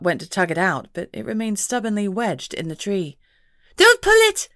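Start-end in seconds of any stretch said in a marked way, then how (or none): 3.8-4.5 high, loud pixie shout. A narrator's reading. Pixie to Scott tugging the sack, shouting high. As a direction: yell at him to stop. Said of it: "Marcia shouted".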